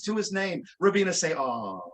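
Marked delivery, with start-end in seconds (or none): 1.3-1.8 low, soft, drawn-out coo; sympathetic aww. Shown as a demonstration. Performer cueing everybody to coo, sympathetic aww. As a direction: lead the crowd in a falling aww. Cues volunteer's sympathetic sigh.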